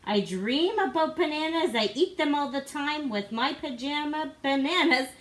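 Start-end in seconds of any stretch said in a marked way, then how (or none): none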